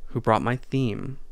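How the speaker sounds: ordinary talk, nothing marked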